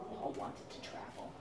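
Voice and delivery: hushed storybook whisper; secret whisper. A narrator's reading. Parent telling kids the pigeon's secret, whispered.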